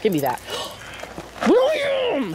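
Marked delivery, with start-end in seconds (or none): 1.5-2.3 high startled shriek of William; shrieking name. Real, not acted. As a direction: scream his name in alarm. Mom shrieks at son burning phone.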